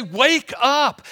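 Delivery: high, loud call to wake him; urgent shout. Pretend. Sailors rousing Jonah, shouted.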